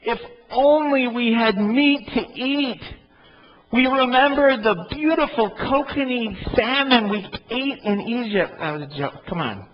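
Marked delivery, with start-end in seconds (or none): 0.0-8.5 whiny voice. Acted. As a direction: whine it like a spoiled child. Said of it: "I started to hear this in a whiny voice".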